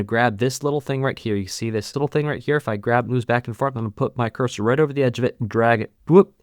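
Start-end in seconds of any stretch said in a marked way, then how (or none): 6.1-6.3 short, high "whoop" sound effect; quick vocal whoosh for a movement; playful whoop. Shown as a demonstration. Tutor dragging notes across the screen, playful whoop.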